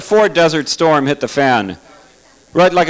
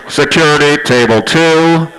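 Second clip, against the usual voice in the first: louder, slow announcer call; mock security call; 5 dB louder, slower than usual. Comic calls security on a table, loud slow mock page. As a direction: boom it like a PA call. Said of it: "calling security on heckler".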